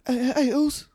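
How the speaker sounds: high mock English chant, lightly done; hint of an English accent. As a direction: chirp it in a put-on English accent. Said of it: "just say that in an English".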